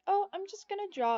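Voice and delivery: lightly voiced nervous girl, pitched high; airy daughter voice. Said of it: "so she's like".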